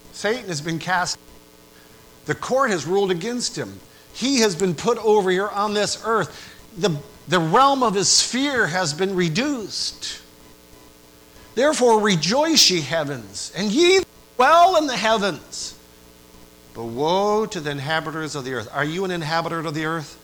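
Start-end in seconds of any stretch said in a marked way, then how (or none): 0.1-10.2 rising, louder preaching about Satan cast down; fiery preaching. Acted. Preacher to the congregation, rising and louder. 11.5-18.7 high, loud proclamation of the verse; fiery scripture reading. Acted. Preacher reading Revelation aloud, loud and high.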